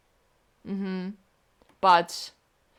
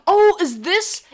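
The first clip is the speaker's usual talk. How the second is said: surprised; 10 semitones higher, 6 dB louder, slower than usual. Real, not acted. Sudden realisation about the plot.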